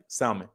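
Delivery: "salmon" said his way, SOW-min; own salmon pronunciation. Shown as a demonstration. Streamer showing chat how he says it, own pronunciation. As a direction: say "salmon" with an ow in the first syllable. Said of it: "I say it, like, perfectly".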